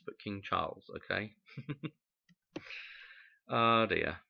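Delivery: normal talking voice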